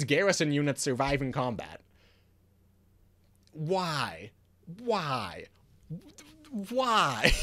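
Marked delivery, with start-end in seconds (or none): 3.5-5.4 drawn-out, falling "why"; exasperated why. Real, not acted. Player lamenting an overpowered card design, exasperated. 6.5-7.3 higher, drawn-out wail of "why"; exasperated wail. Real, not acted. Player protesting yet another broken card, wailing in exasperation. Third, escalated cry over the card.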